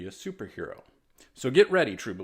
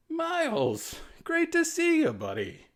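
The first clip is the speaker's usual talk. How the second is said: dazed greeting; slower than usual. A narrator's reading. Woozy Peter greets Miles.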